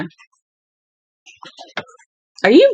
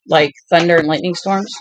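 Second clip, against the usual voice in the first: ordinary talk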